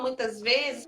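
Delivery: usual speaking voice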